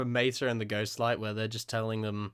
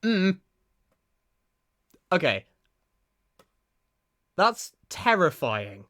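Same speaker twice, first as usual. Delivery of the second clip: ordinary talk, nothing marked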